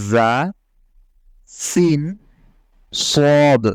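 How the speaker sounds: sharp hissing Arabic জা সিন সদ; Arabic letter demo. Shown as a demonstration. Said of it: "উচ্চারণ করতে হবে".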